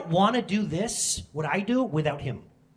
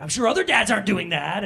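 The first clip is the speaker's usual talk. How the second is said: loud, higher-pitched mock protest; frustrated inner thought; 4 semitones higher, 7 dB louder than usual. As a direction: act out an exasperated dad's thought. Voices his own frustrated thought.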